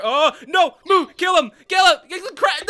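high, loud panicked commands; urgent shouting. Real, not acted. Gamer telling partner to get the crab, urgent shouting. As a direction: shout orders at your teammate. Urgent orders during play.

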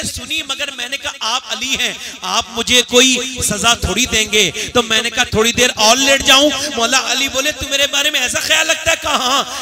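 loud, building sermon story; rousing storytelling. Acted. Preacher telling Mola Ali's tale, loud and rising. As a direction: drive the story to its climax.

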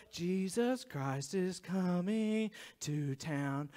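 slow, low, hushed mock prophecy; mock doomsayer's slow, soft proclamation; would-be savior. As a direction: drag it out ominously, dropped in pitch. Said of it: "They're gonna say".

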